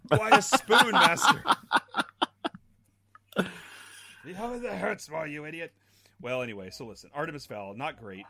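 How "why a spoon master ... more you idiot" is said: higher, laughing sneer of a villain; mock the servant with a spoon threat; Alan Rickman impression. Quoting Rickman's sheriff to friends, laughing villain sneer. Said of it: "Alan Rickman rewrote his character".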